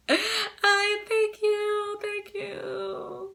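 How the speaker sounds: high, slow, far-off sobbing thank-you; crying thanks. Retold or imitated. Patient sobbing her thanks to the doctor, crying. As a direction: thank him through tears, slowly and high. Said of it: "like, crying".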